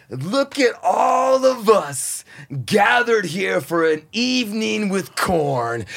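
slow, loud, high showman opening; hammed-up concert host intro; grand stage announcer. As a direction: welcome the whole theater grandly. Grand live intro on mic.

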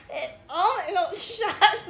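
loud, high, laughing outburst; cracking up at high volume; laughing protest. Real, not acted. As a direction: protest through helpless laughter. Laughing helplessly while protesting.

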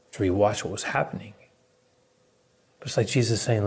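ordinary talk

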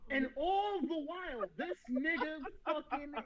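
ordinary talk

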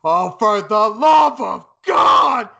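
loud, high, slow shouted call; Jim Ross shout. Retold or imitated. S0 imitating the announcer's call as the glass shatters, loud shout. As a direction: belt it like a stunned commentator. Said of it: "you just hear Jim Ross go".

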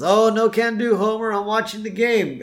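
casual high-pitched excuse; Lenny's voice. Retold or imitated. Lenny turning Homer down on the phone, high and breezy. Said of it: "Lenny answers and he says".

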